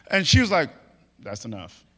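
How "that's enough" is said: low, quiet deadpan; dry, dropped-voice put-down; unimpressed. Acted. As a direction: shut down the excitement softly. Mary dampens the excited angel.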